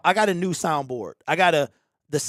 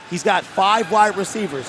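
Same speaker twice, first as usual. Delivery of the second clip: ordinary delivery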